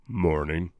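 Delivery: low, gruff morning greeting; deep voice. Retold or imitated. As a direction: drop the voice deep. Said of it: "my normal voice isn't that deep".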